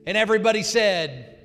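loud, high closing amen; rally the room to answer; rousing call. Real, not acted. Pastor calls the congregation to shout amen, loud and high.

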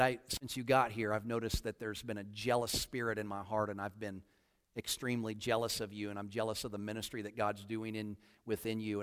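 low, hushed, subdued confession; retold weeping confession. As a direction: admit it softly, a touch of sorrow. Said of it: "I'm weeping at this time".

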